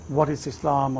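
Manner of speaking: normal talking voice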